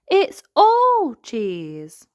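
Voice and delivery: high, loud, very slow wonder; Peppa in awe. Acted. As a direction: marvel at a cheese world. Peppa amazed.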